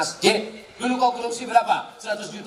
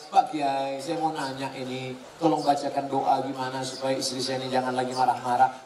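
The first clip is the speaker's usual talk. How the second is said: timid pleading husband; 8 semitones lower, 3 dB quieter than usual. Pretend. Husband begs the cleric for a prayer.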